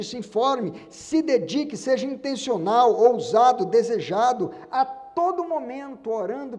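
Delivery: loud, slow, ringing preacher's call; full-force preaching. Acted. Preacher urging the congregation, loud and fervent. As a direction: drive each command home to the church.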